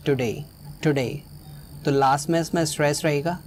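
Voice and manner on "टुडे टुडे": Today repeated with डे stressed; short टु, weight on डे in today; stress on last syllable. Shown as a demonstration. Teacher modelling today, stressed on the last syllable. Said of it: "जो stress होगा वो last में होगा".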